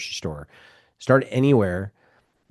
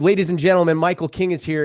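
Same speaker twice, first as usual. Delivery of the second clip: announcer intro; 8 semitones higher, 6 dB louder than usual. Retold or imitated. Friend announces him at event.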